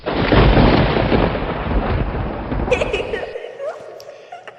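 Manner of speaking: high, breathless spooky cackle; ghostly cackle. Acted. Ghostly laugh after tagline.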